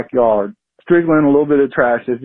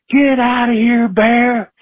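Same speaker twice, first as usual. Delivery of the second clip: yelling at a bear; 10 semitones higher, slower than usual. Retold or imitated. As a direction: shout it high to scare the bear away. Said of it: "and I yelled".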